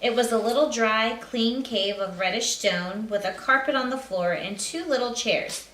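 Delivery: plain reading voice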